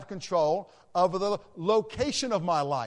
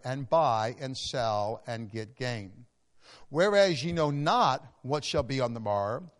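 scripture reading; 4 semitones lower than usual. Acted. Preacher reading the verses to the congregation, measured. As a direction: read the text with weight.

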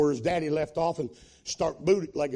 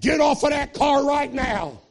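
high, loud, fast-rising revival preaching; preaching at full force; 8 semitones higher, 7 dB louder than usual. Acted. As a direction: shout the testimony to the rafters.

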